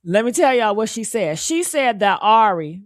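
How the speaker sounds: ordinary delivery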